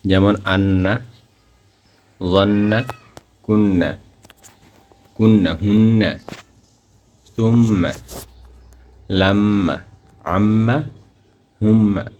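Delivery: আন্না with the নন hummed through the nose; with ghunna. Shown as a demonstration. Teacher shows nasal ghunna on each example. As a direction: hum the doubled ন and ম. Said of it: "গুন্না করে পড়াকে ওয়াজিব গুন্না বলে".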